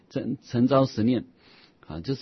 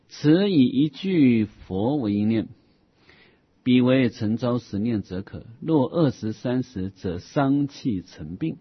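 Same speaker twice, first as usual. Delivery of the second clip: slow, measured reading of scripture; measured recitation of an old Buddhist text; formal text recitation; 5 dB louder than usual. Acted. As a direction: read the classical passage slowly and deliberately. Reads a classical Buddhist text aloud.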